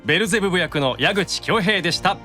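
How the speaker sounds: usual speaking voice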